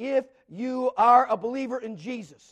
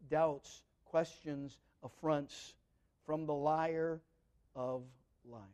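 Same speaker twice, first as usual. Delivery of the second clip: hushed and grave; 8 semitones lower, 10 dB quieter, slower than usual. Real, not acted. Preacher to his flock, naming the devil's doubts in a hush. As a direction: sink to a low, soft voice, word by word.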